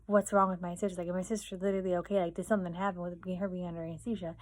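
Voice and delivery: ordinary delivery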